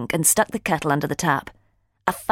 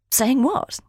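higher-pitched, feigned innocence; deliberately vague; 8 semitones higher than usual. A narrator's reading. Holly dodges her friend's question, deliberately vague. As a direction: play vague, as if you've lost the thread. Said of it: "deliberately vague".

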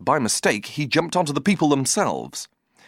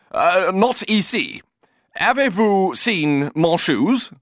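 slow, higher, pleading bad French; halting broken French; 7 semitones higher, 4 dB louder, slower than usual. A narrator's reading. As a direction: spell it out slowly for a foreigner. Cyril tries broken French.

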